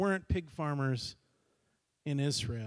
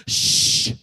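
a loud, breathy hush that cuts him off; 13 dB louder than usual. Acted. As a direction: shush him mid-speech. Father hushes son's speech.

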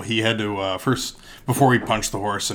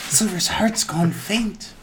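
mock-tragic narrator; 13 semitones higher, 4 dB louder, slower than usual. Narrating horse's plight dramatically.

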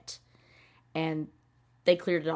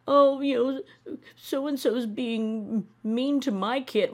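high, slow mocking whine; sing-song mocking of a whiny parent; whiny mock complaint; 5 semitones higher, slower than usual. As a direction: drag it out like a spoiled complainer.

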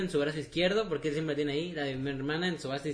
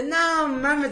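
startled no mames; 10 semitones higher, 5 dB louder than usual. Retold or imitated. Retold moment of a sneezed snot.

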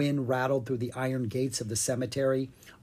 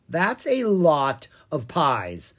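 slow, louder amazement; gasping amazement; 4 semitones higher, 7 dB louder, slower than usual. A narrator's reading. Ella sees stacks of pies, gasping in amazement. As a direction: marvel with a touch of a gasp. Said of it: "Ella gasped".

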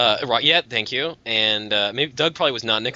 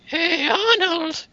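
put-on old grandpa voice; much slower than usual. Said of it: "They'd be like".

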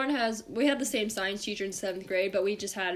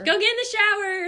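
high, loud mock holler; yelling mom; 12 semitones higher, 8 dB louder than usual. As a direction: holler like a mom summoning her kid. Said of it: "she started yelling for me".

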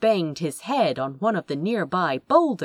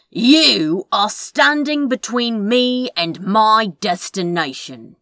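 intoning villain; 4 semitones higher, 6 dB louder than usual. Acted. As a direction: intone it grandly, like a dark sorcerer. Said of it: "he intoned in his deepest voice".

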